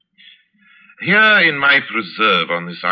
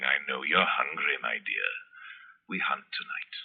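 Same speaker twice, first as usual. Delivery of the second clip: a sinister promise breathed to the bird; menacing murmur; 6 semitones lower, 13 dB quieter than usual. Acted. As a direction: lower and much softer, fading to a near whisper. Zaroff murmurs to his falcon.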